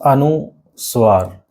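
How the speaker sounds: anusvaar drawn out slowly; slow term demo. Shown as a demonstration. Teacher to student, slowly drawing out अनुस्वार. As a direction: stretch अनुस्वार out syllable by syllable, slowly. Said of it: "ये जो आपका".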